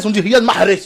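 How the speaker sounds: "Riyad Mahrez" with a strong Arabic h; mock Arabic accent. Arabs correcting the footballer's name, mock Arabic accent. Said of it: "Si les Arabes aussi".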